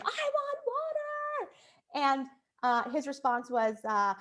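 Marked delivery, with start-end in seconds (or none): 0.0-1.5 high, slow whiny demand; screaming toddler. Retold or imitated. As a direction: draw it out like a stubborn little girl. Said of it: "she'd scream".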